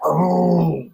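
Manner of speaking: ordinary delivery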